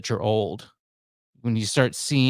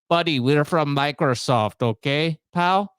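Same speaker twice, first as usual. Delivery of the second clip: mock Indian accent, punchy and insistent; caricatured Indian accent, pushy scammer; 2 semitones higher, 3 dB louder than usual. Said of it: "I'm sorry for the accent".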